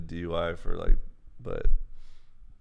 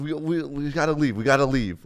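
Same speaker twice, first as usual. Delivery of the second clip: urgent stammer; 6 semitones higher, 5 dB louder than usual. Retold or imitated. Urgent escape.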